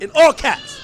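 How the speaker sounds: high, loud, punchy call; shouting. Shown as a demonstration. Describing [name]'s text as all caps, shouting. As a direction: belt it out in capital letters. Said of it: "Like he's shouting".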